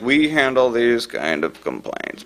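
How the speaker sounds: slow, droning recorded official; bureaucrat English. Retold or imitated. Labor department phone recording, imitated bureaucrat voice. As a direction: drone it slowly like an office recording. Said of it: "bureaucrat English".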